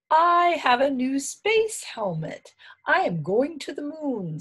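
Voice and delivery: high, loud proud announcement; storybook Little Bear, excited; excited cub. A narrator's reading. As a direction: declare the big adventure in a high child-like voice. Little Bear declares moon trip.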